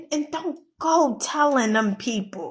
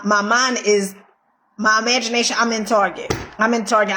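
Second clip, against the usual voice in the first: usual speaking voice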